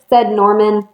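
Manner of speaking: plain reading voice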